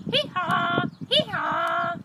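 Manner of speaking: high, loud "hee-haw" bray; donkey braying. Acted. Donkey making music with friends, loud braying. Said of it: "The donkey with his".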